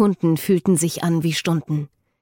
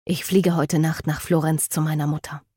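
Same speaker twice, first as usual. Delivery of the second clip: usual speaking voice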